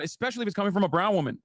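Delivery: usual speaking voice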